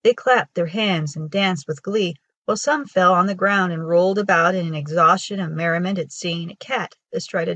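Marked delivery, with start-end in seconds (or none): none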